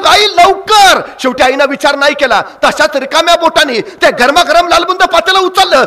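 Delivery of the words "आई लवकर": higher and louder impatient yell; boy's shout. Acted. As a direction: shout for mother to hurry. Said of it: "पोराची पुन्हा आरोळी".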